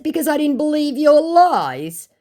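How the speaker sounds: high, loud, stretched-out comeback; exaggerated taunting answer; mocking retort. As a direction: throw the reply back slowly and high. Sarcastic quoted reply to scammer.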